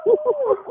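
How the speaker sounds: sudden high yelp, shrieking up; imitated yelp of a wolf let go. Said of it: "all you hear is".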